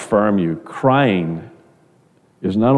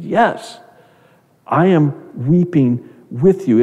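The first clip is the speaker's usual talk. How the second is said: tender reassurance; 6 semitones higher, slower than usual. Retold or imitated. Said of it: "And he said".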